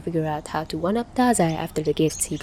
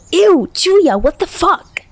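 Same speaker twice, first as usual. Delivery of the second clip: high, loud disgusted whine; complaining; 12 semitones higher, 7 dB louder, slower than usual. Acted. Tachihara complaining, grass on his head. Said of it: "Tachihara complained".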